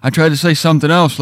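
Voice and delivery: ordinary delivery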